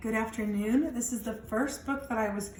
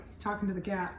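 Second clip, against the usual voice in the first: usual speaking voice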